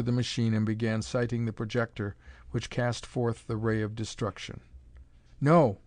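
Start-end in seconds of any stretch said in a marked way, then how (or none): 5.4-5.8 louder, higher shout to stop him; cried out. Acted. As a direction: cry out to stop the killing. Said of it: "cried Hale".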